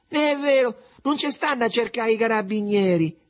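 put-on very high, piping child's denial; loud, high-pitched chirp; chirping little boy. A narrator's reading. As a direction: chirp it out like a small kid. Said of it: "e Mariuccio cinguettò".

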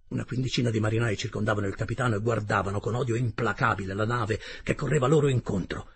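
usual speaking voice

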